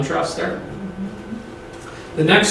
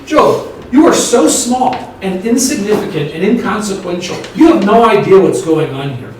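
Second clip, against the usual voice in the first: raised, sharp scolding, higher; harsh rebuke; 4 semitones higher, 5 dB louder than usual. Shown as a demonstration. God harshly scolding Job, a hard rebuke. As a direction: cut him down to size. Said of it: "The harsh way to be".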